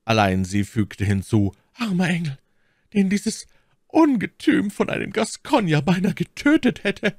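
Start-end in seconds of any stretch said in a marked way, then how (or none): 1.7-7.1 very high feminine character voice; Milady, pitying. A narrator's reading. Milady consoling the count nearly killed by a Gascon, high-voiced. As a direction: pity him in a high, indignant lady's voice. Milady pities her supposed lover.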